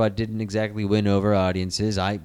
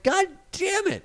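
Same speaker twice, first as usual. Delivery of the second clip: much higher, whining; whiny complaint; 8 semitones higher than usual. Retold or imitated. A fan whines about the Spider-Man recast, much higher. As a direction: whine it out high like a let-down fan.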